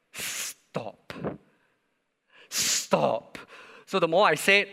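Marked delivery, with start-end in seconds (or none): 0.1-1.1 whispered stop. Retold or imitated. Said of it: "my stop gets louder". 2.5-3.4 firm stop. Retold or imitated. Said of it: "my stop gets louder".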